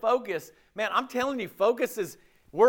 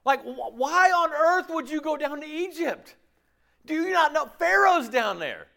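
mocking disbelief; 9 semitones higher than usual. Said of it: "people in Midian would go".

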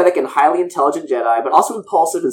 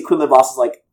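ordinary talk

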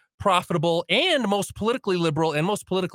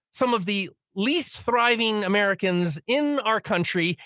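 ordinary talk, nothing marked

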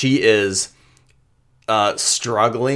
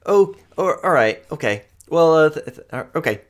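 put-on higher, flustered young woman brushing off help; nervous Amoya; 7 semitones higher than usual. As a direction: stammer out that she's fine, pitched up.

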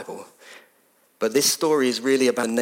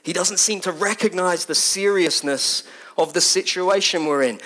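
ordinary talk

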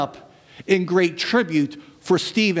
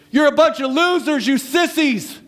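high, raised jeer, hammed up; mock loud cry; 8 semitones higher than usual. Preacher misquoting Stephen again as a joke, loud high mock taunt. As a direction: hurl it as a playground insult. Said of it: "cried out in a loud voice".

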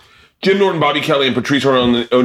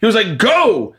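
exasperated friend urging him to go; 13 semitones higher than usual. Retold or imitated. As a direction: shove him out the door with your voice. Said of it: "Patrice is like".

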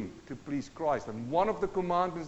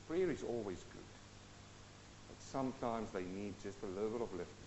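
ordinary delivery

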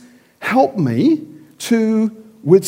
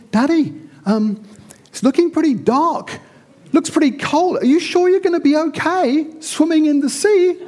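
a lightly voiced small child, higher and worried; child's worried voice; 8 semitones higher than usual. Child asking his father before a swim, mildly worried. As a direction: ask like an anxious little kid.

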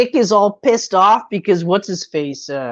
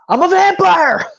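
higher, louder excited blurt; 6 semitones higher, 4 dB louder than usual. Retold or imitated. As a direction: shout the secret out proudly. Nick blurting out his secret.

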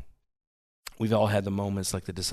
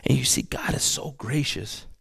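hushed whisper of awe; 3 semitones higher than usual. Acted. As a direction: breathe it as a secret. Whispered aside in sermon.

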